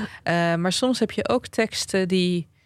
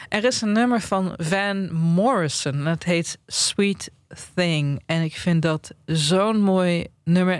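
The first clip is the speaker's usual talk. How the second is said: ordinary talk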